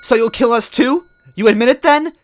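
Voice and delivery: put-on high, loud desperate girlish yell; Toadette yelling. Toadette yelling at Smithy over her brother. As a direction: cry out for her lost brother.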